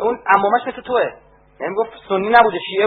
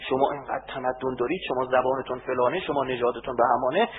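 softer, lower, sweet-talking mock flattery; mock flattering coax; 8 semitones lower, 8 dB quieter than usual. Imitating enemies flattering Azeris, in a soft, lower coaxing voice. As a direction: coo it like buttering someone up. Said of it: "شروع کن روحیه بده".

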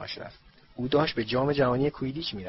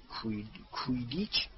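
muttering; 7 dB quieter, slower than usual. A narrator's reading. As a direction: mutter the strange word, much softer. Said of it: "زمزمه کرد".

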